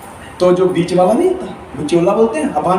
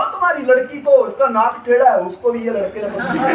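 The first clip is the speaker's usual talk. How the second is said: ordinary delivery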